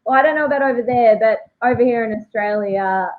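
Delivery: ordinary talk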